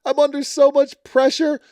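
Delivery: high, whiny fake-sob voice, hammed up; mock crying. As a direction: whimper it like a reality star about to cry. Mocking Courtney's tearful confession.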